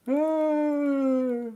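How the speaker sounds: heavy drawn-out sigh; long exasperated sigh. Real, not acted. Streamer groaning at a lost bet, long sigh.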